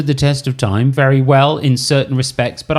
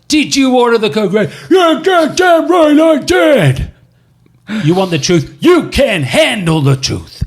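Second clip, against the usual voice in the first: much higher, loud, slowed shouting fury; hammed-up courtroom explosion; Jack Nicholson yell; 11 semitones higher, 5 dB louder than usual. Co-host shouts Nicholson's courtroom outburst, higher and louder. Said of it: "that's who I was supposed to be".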